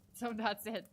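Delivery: usual speaking voice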